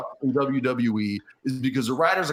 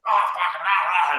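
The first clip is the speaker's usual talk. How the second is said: mock yelling; 5 semitones higher, 3 dB louder than usual. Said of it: "and screaming and yelling".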